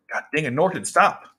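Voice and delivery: annoyed order to stop; real exasperated scolding. Real, not acted. Real scolding that interrupts the reading.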